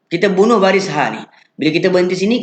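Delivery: ordinary talk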